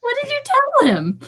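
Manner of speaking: very high-pitched mock panic; exasperated demand. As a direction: squeak out the question in outrage. Demanding answer from companion.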